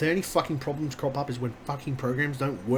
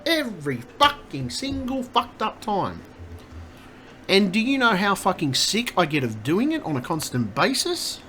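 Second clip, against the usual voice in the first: louder and higher, venting fury at the software; building angry rant; 5 semitones higher, 3 dB louder than usual. Real, not acted. Streamer venting at buggy software to chat, angry rising rant. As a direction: let the frustration boil over into yelling. Streamer ranting at broken programs.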